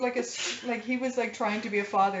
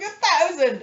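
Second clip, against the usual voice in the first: high, loud triumphant announcement; excited; 10 semitones higher, 7 dB louder, slower than usual. Retold or imitated. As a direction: blurt it out thrilled. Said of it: "And I was like".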